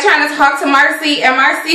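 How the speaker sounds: ordinary talk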